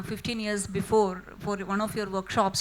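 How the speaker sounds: ordinary talk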